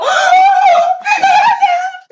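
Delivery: high, loud delighted gasp; excited gasp. Real, not acted. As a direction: gasp in giddy surprise. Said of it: "No manches". (jadeo de sorpresa emocionada)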